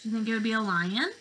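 put-on lively, sing-song picture-book voice for little kids; reading to children. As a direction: read it like circle time, drawing them in.

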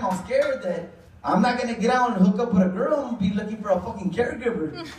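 normal talking voice

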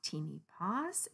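ordinary delivery, nothing marked

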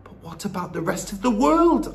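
put-on high-pitched boy's protest; crying out. A narrator's reading. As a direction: cry out the question urgently. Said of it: "I cried".

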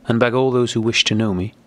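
usual speaking voice

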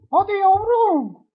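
"Hadi yavrum" squeaked very high, slow and sweet; falsetto coaxing. Acted. Gamer coaxes his bird to fly, in falsetto baby talk.

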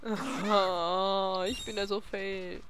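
ordinary delivery

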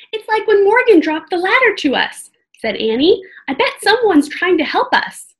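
put-on a bold kid urging caution; young girl voice. Annie's voice in read-aloud.

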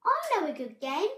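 high, loud, quick and eager; Squirrel's voice. A narrator's reading. Squirrel suggests a game, quick eager voice. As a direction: pitch it up with eagerness. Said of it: "said Squirrel".

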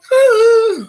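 a loud, high, shouted sigh of overwhelm; huge sigh. Real, not acted. Dad overwhelmed by his son's care package, huge sigh. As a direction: let out a big high cry of a sigh.

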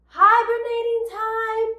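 high, loud sing-out announcement; game call. Shown as a demonstration. Teacher explaining the hibernating game, high loud call. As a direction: call it out high to a roomful of kids. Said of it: "So I will say".

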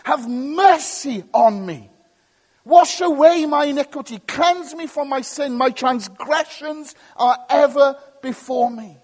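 loud, high, fervent plea; crying out in repentance. Acted. Preacher voices David's repentant prayer, crying out high and loud. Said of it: "he cries out to God in repentance".

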